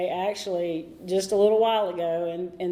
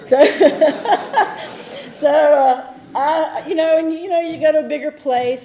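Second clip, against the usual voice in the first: words pushed out through a loud laugh, higher and louder; 6 semitones higher, 9 dB louder than usual. Real, not acted. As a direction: laugh it off as you keep talking. Laughing at her college memories.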